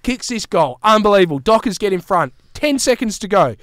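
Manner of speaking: excited retelling. Retold or imitated. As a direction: replay the last ten seconds like a caller. Recreating tense final seconds.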